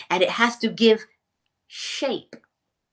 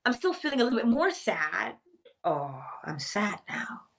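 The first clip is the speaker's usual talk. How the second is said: high and mildly wistful, sinking to a whispered "sad now"; a lightly acted slide from cheerful to sad; mock sadness; 7 semitones higher, 3 dB quieter than usual. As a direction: let the happiness drain away line by line. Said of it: "You're like".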